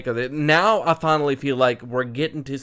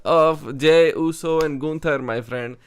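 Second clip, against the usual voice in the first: put-on Indian accent; 5 semitones higher, 5 dB louder than usual. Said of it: "your Indian accent".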